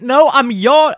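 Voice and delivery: high, loud mock outburst; exasperated yell. Retelling his protest to people who disbelieve him, exasperated yell. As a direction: burst out in protest, cut off mid-word.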